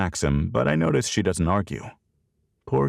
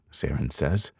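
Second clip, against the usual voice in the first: plain reading voice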